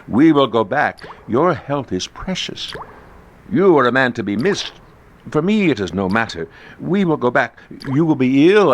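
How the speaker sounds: high, smooth courtly flattery; lightly voiced scheming host; voiced Montresor. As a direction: lure him in with a sweet lilt.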